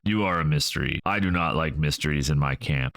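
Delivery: plain reading voice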